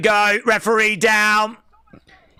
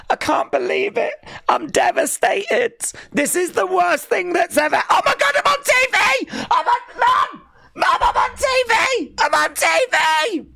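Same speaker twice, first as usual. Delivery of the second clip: imitating excited kid; 12 semitones higher than usual. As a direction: play the sobbing kid who spots himself on TV and shrieks to Mum. Voicing crying kid who sees himself.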